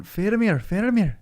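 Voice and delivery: a light Scottish "Faramir" with tapped r; mild Scottish accent. Retold or imitated. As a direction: Pippin calling out in a hint of Scottish. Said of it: "He's going".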